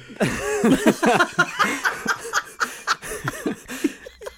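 long high-pitched laugh; high laughing fit. Real, not acted.

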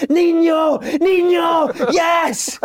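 high, loud mock yell of a name; shouting for the ball. Jim O'Brien, imitated by a teammate, yelling at Juninho for the ball. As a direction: bellow for the pass from far away. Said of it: "all I can hear is Jim shouting".

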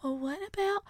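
high, soft interrupting voice; mock meddling woman, small and high; Karen. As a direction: pipe up timidly high from the corner. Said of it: "you hear Karen over in the corner".

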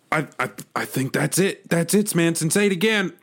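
building excitement; excited. Acted. Wormhole reacts to chant.

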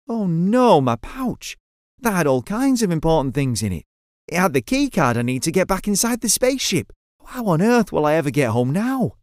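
high, anxious outburst; worried. Acted. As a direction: panic about being stranded. Loses his pouch.

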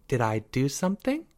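soft, innocent question; mock innocent. A narrator's reading. Girl playing innocent to her accuser, soft. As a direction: ask as if butter wouldn't melt. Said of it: "she asked innocently".

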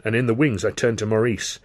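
plain reading voice

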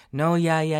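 light impersonation of a friend; impression of Shauna. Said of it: "It's a bad impression of Shauna now".

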